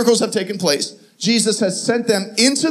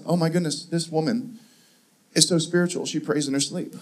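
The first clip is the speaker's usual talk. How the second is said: hushed thought; 4 semitones lower, 6 dB quieter than usual. Retold or imitated. His hushed thought in the night.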